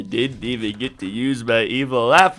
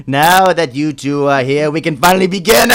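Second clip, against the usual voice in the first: gloating villain; 7 dB louder than usual. Acted. Villain to captive heroes, loud gloating cackle. Villain announces plan, laughs.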